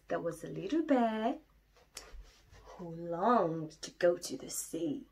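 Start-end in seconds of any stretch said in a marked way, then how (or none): none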